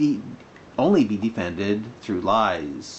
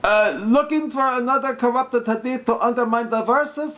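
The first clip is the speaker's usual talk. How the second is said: higher-pitched put-on Arabic accent; mock Arabic accent; 11 semitones higher than usual. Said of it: "when I'm doing the mock Arabic accent".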